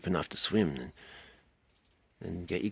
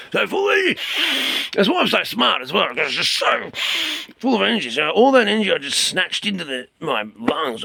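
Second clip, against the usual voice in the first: much higher, louder, bursting with energy; buzzing with energy; 13 semitones higher, 9 dB louder than usual. Real, not acted. Teacher after compressing energy, higher and bursting out.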